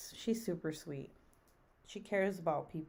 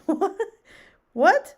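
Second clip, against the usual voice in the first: shrieked disbelief; 12 semitones higher, 14 dB louder than usual. Real, not acted. Reacting to "yeah boy.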